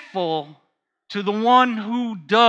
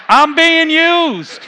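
much higher and louder, slowed, as if crying foul; loud mock protest; 9 semitones higher, 9 dB louder, slower than usual. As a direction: exclaim it with playful outrage, drawn out. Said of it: "Now I'm saying".

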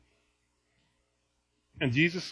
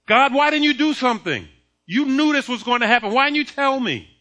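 loud, high mock protest at God; hammed-up indignant blaming; accusing complaint; 5 semitones higher, 5 dB louder than usual. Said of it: "complaints and accusations".

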